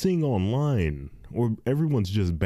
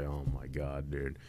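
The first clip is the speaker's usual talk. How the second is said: low, much softer groan of dread; exasperated groan; 8 semitones lower, 12 dB quieter than usual. Retold or imitated. Dreading tire shipments, low soft groan. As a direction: sink into a weary groan.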